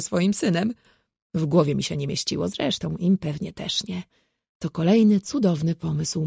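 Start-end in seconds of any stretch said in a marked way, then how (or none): none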